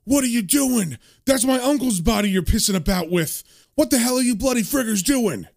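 high, outraged acted tirade; furious demand, volume only slightly up; angry yelling. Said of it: "he's yelling".

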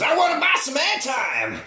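high manic shout breaking into a squealing cackle; manic Chop Top. Retold or imitated. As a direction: shriek it like an unhinged villain, then cackle. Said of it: "Bill Moseley says".

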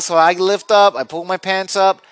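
ordinary delivery

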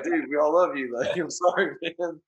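mock heartfelt plea. Fan consoling [name], mock heartfelt plea. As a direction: implore a friend, half laughing.

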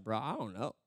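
low, soft, helpless reply; clueless thief. Acted. Thief giving up on the angel's questions, clueless. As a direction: give up quietly, totally stumped. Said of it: "the thief is just like".

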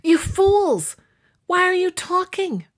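hammed-up indignant cry; exasperated outburst. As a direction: pipe up high, scolding the others. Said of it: "The third friend piped in".

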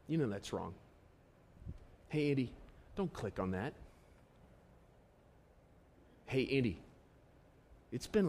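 soft, low, gentle murmur with long pauses; God's whisper. Acted. God gently nudging Andy in prayer, a soft whisper-like voice. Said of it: "it's like a whisper".

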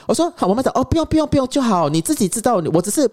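high, placating mom imitation; comic high motherly voice; mother backing off.

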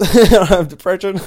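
laughing through the words; loud denial half laughing. Real, not acted.

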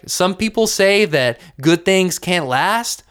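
ordinary delivery

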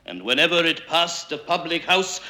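usual speaking voice

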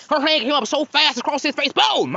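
ordinary delivery

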